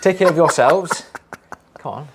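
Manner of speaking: loud laugh-filled sign-off; laughing loudly. Real, not acted. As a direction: crack up on every word. Repeat attempt, laughing harder.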